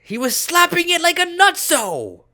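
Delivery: high, loud, scandalised yell; hysterical outburst. Acted. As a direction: cry it out in mock-hysterical disbelief. Appalled climax of the wild story.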